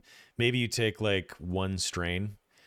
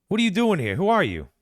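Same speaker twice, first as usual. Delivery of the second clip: high, loud alarmed yell; loud high startled guard; startled shout; 13 semitones higher, 10 dB louder, faster than usual. Acted. Guard confronts intruders, shouting.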